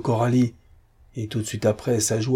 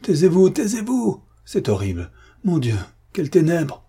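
much higher and louder, desperate; anguished cry; 8 semitones higher, 3 dB louder than usual. A narrator's reading. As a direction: shout him down in horror. Patrice refuses the truth. (Patrice fait taire Don Luis, cri désespéré)

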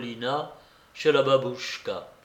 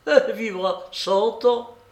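high, raised woman's voice; parting cry; 8 semitones higher, 4 dB louder than usual. A narrator's reading. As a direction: call it out mockingly to the general. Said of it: "sortit en criant au général". (cri lancé en sortant)

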